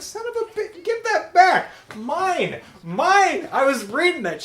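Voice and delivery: high-pitched outraged yelling, played for laughs; hammed-up indignant protest; indignant mock shrieking. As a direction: squawk as if someone snatched it from you. Retold woman fights for magazine.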